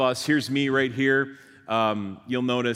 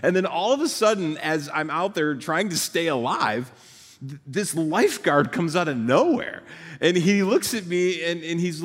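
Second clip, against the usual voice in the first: usual speaking voice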